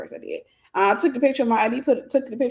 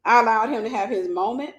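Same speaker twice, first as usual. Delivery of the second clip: normal talking voice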